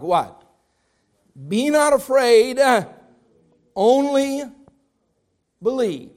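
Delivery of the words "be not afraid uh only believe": louder and slow, stress on not; emphatic recital. Shown as a demonstration. Leading the church in a memory verse, emphatic. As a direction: plant each word for the church to repeat. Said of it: "let's say that together".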